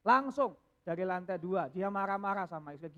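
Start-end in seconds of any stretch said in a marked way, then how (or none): none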